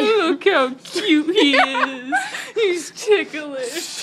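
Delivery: slow words broken by laughter; cooing through giggles; laughing. Real, not acted. Teasing friend being tickled.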